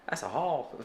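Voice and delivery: attempted Texas drawl on haul; Texas accent. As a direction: try a Texas accent, admitting you can't. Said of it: "I can't do a Texas accent or whatever".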